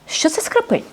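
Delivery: насторожено. Acted. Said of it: "насторожено раптом запитав".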